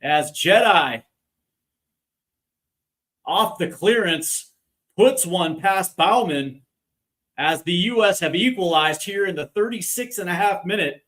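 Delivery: high, thrilled play-by-play after a goal; excited commentary. Real, not acted. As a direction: still buzzing, call the replay high. Excited replay after the equalizer.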